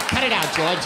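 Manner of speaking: ordinary talk, nothing marked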